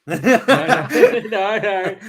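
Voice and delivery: high, loud laughing reassurance; real laugh breaking into the words. Real, not acted. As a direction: wave away an apology through laughter. Laughing off an apology.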